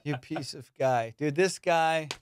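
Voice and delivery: usual speaking voice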